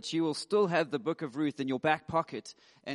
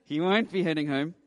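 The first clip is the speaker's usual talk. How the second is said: mock threatening listener. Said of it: "It's probably that, like".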